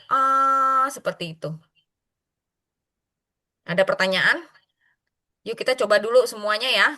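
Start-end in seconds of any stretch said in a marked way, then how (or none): 0.1-0.9 Ah held high and steady; Ah on one high level pitch. Shown as a demonstration. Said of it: "Dia stabil nadanya".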